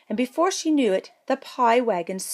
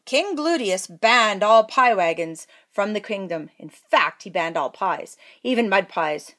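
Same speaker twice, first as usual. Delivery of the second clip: ordinary delivery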